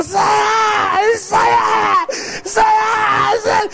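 hammed-up ninja yells, spaced out blow by blow; mock battle cry on each lash; hose-strike cries. Mom whips the brother, mock ninja shouts.

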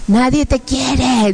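slow, drawn-out demonic taunt; taunting demons. Acted. Demons tell her nobody loves her, slow taunt. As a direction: stretch the words out as an accusing demon. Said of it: "decían".

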